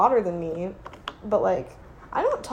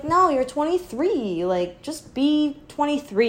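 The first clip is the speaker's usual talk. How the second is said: exasperated; 8 semitones higher, slower than usual. Retold or imitated. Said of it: "and it's like".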